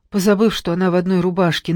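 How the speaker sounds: plain reading voice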